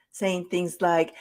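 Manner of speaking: usual speaking voice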